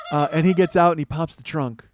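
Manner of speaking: ordinary talk, nothing marked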